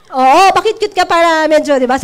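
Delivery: ordinary delivery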